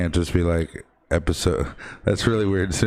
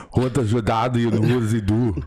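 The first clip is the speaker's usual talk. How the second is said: higher, hammed-up put-on voice; movie impression; 4 semitones higher than usual. Host quoting a film at co-host, hammed-up impression. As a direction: play the quote up as an impression.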